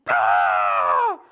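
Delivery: very loud, long acted scream; hammed-up bawling cry; mock child's wail. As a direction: let out a drawn-out imitation howl. Imitates daughter's cry after smack.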